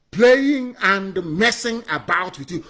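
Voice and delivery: slow, deliberate, word by word; slow emphasis. Real, not acted. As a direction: spell out playing and messing slowly. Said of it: "repeats the accusation slower".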